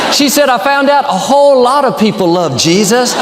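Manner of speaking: loud shouted quote. Retold or imitated. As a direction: call it out loud. Quoted woman, shouted line.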